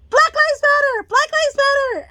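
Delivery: high, loud involuntary shout; tic shout. Retold or imitated. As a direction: blurt it out at the top of the voice. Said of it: "it starts to fucking shout that shit out".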